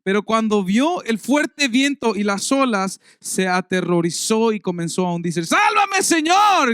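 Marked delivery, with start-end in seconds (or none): 5.4-6.7 loud, high cry for rescue; desperate shout. Acted. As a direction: shout it like a man sinking in the waves. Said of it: "gritó". (grito desesperado pidiendo auxilio)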